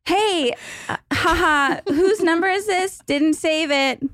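high-pitched, mock-polite imitation; awkward retold reply. Reading out a reply to an unknown number, high and awkward. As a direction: lift the voice high for a sheepish brush-off.

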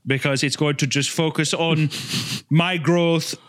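put-on lightly accented channel-update voice; mock-sincere influencer announcing personal growth; Hitler as earnest vlogger. Vlogger tells fans about a big channel change, mock earnest.

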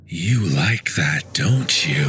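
low, slow, leering mutter; low slow sleazy radio voice; creepy DJ mutter. Acted. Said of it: "then mutter".

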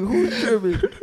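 high, loud, baffled question; wake-up confusion, thrown back at him; incredulous who's tripping. Retold or imitated. At two in the morning to the sleep-talker, loud, high and baffled. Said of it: "I was like".

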